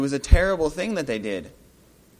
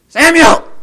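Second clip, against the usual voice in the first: Samuel! yelled even higher and louder; louder summoning shout; 13 semitones higher, 18 dB louder than usual. Acted. The summoner calling Samuel! a second time, even louder. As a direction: yell Samuel! once more with full force.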